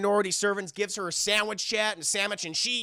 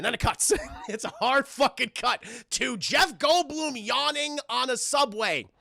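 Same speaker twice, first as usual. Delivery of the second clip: ordinary delivery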